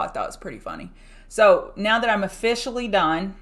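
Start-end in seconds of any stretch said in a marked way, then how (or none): 0.0-0.9 low muttered aside. Real, not acted. To her stream viewers about a Twitter DM, a low quiet aside. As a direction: repeat it much lower and softer, almost to herself.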